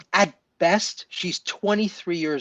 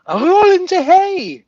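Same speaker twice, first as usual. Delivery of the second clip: loud high movie quote; 10 dB louder than usual. Retold or imitated. Friend shouting a Young Frankenstein line in delight.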